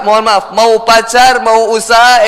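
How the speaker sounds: ordinary talk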